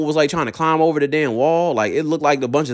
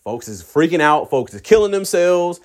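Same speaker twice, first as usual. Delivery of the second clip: ordinary delivery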